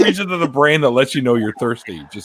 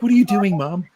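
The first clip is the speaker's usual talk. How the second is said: mock reproach; 8 semitones higher than usual. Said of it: "slap your mother".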